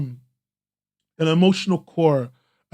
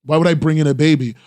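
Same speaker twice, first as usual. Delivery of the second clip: ordinary talk, nothing marked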